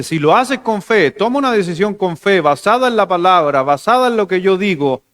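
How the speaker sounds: high, loud sermon proclamation; preacher rising in emphatic waves; forceful preaching. Real, not acted. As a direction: proclaim God's promise phrase by phrase. Preaches God's answer forcefully.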